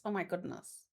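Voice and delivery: normal talking voice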